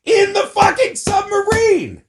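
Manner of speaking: high outraged rant building into a full shout; exasperated yelling that peaks very high and loud. Real, not acted. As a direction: yell it out, climbing to a screamed last phrase. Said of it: "pissed 'cause I'm yelling".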